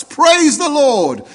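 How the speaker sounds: very high, stretched-out praise cry; exultant shout. Acted. Old lady finding groceries on the porch, exultant shout. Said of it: "and she shouts".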